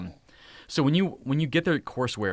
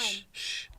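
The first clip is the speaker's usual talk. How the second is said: soft double shush; hushing; 9 semitones higher, 10 dB quieter than usual. Real, not acted. Hushing noisy kids during livestream.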